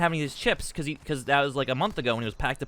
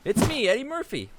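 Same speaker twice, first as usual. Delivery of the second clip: higher, stretched-out celebrity greeting; Eddie Murphy; 8 semitones higher, 3 dB louder than usual. Acted. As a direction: announce yourself in a high put-on voice. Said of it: "Yeah, that's how he talks".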